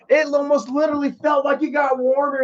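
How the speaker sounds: emphatic repeat. Real, not acted. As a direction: hammer the line home in disbelief.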